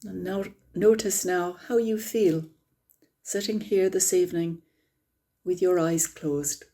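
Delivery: calm, unhurried meditation guide; guided meditation. Acted. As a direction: ease the group gently into stillness.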